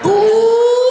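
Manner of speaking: high loud squealing ooh, imitating her; mock high ooh. Comic mocking the woman's laugh, high squeal. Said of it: "She said".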